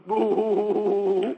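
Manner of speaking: a brief imitated sob, "boo"; short sob sound. Shown as a demonstration. Teacher imitating a short crying sound. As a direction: give one quick crying "boo". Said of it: "people cry in short sounds".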